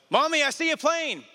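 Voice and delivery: put-on high little-boy voice; excited toddler calling out; child voice. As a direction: pipe it up like a small boy. Son voiced in retold story.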